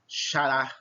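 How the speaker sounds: xará drawn out slowly, weight on RÁ; stressed last syllable. Shown as a demonstration. Teacher showing an oxítona, stressing the end of xará. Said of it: "pronuncio com mais força a última".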